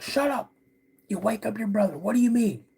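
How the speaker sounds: high-pitched hushed-down scolding; urgent, high parental scolding without shouting; scolding dad. Retold or imitated. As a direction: imitate an exasperated dad telling off a kid. Dad scolding child in story.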